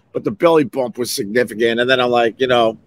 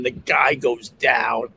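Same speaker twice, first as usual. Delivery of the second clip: normal talking voice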